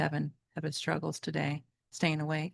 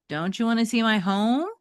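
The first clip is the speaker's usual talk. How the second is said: higher, louder pleading question; 6 semitones higher, 5 dB louder than usual. Acted. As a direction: beg him to come along. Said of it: "Metalhead pleaded".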